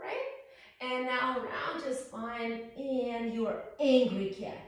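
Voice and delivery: usual speaking voice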